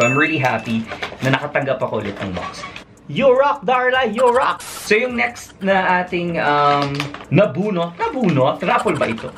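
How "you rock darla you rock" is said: high, bright chant of praise; sing-song cheer to Darla; cheering catchphrase, pitched high. Acted. Repeated cheer catchphrase, high and loud.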